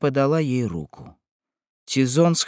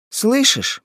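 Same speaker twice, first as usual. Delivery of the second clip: high, falling wail; anguished moan; 11 semitones higher than usual. A narrator's reading. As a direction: moan it out like a madwoman. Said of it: "простонала безумная". (надрывный стон)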